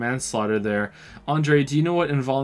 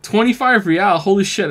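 high, loud amazed exclamation; surprised; 9 semitones higher, 9 dB louder than usual. Real, not acted. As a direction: react to the big payout. Surprise at large sum.

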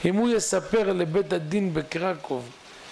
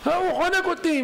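high, alarmed outburst; panicked; 13 semitones higher than usual. Acted. Husband waking from nightmare to his wife, panicked. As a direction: blurt it out high, as if just woken in terror.

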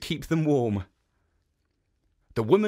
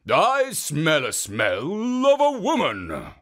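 louder, drawn-out ogre savouring the scent; slow, sniffing ogre; 4 semitones higher, 5 dB louder, slower than usual. Acted. Oni sniffing the air again, slow and hungry. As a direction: slowly relish the smell like an ogre.

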